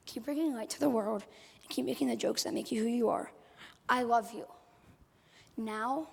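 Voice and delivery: normal talking voice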